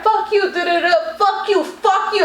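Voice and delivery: high mock tirade; retold angry cursing. Teller imitating the woman's tirade, mock anger.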